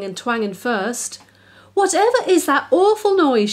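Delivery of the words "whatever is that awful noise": high, loud alarmed shriek; shrieking mum. A narrator's reading. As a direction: shriek at the racket. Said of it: "she shrieked".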